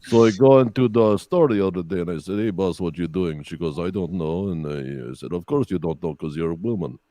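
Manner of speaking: low-pitched Russian comic; deadpan Russian-accented storytelling. Pretend. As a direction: tell it in a put-on Russian accent. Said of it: "Can you do a Russian accent?".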